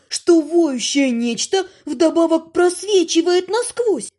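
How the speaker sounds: ordinary delivery, nothing marked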